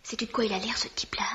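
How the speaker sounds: hushed whisper. Acted. Whispering after leaving room. (chuchoté en confidence)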